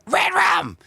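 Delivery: very high, loud screech; screechy scream. Shown as a demonstration. Student copying the coach's trigger word, screechy. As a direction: tighten the throat and screech it. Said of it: "Yeah, I could do that".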